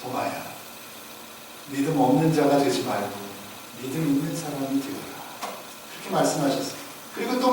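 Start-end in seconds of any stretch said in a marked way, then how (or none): 0.0-5.1 low, soft, gentle admonition; Jesus. Acted. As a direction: quietly counsel a doubting disciple in a lowered voice. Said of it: "주님이 하신 말씀 무엇입니까".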